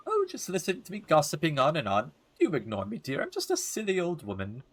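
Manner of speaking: put-on fluttery old lady lilt; gossipy old woman, self-deprecating. Old neighbor excuses her gossiping to the boy, lilting apology. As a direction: play a silly elderly neighbor.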